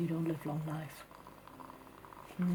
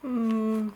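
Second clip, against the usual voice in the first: loud drawn-out hum; 3 semitones higher, 8 dB louder than usual. Real, not acted. Palm reader pondering aloud.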